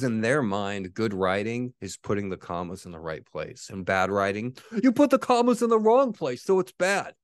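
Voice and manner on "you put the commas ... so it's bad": mocking voice.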